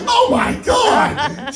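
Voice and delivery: slow, very high, laughing oh my God; stunned. Retold or imitated. Son stunned by his drunk mother, laughing. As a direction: drag it out, jaw on the floor. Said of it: "I'm like".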